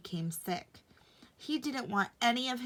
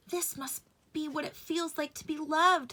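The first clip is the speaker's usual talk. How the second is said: higher, different toy-rabbit voice; dreamy higher rabbit voice; rabbit's inner thought; 7 semitones higher than usual. A narrator's reading. Rabbit musing while snuggled by the boy, high voice. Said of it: "thought the rabbit".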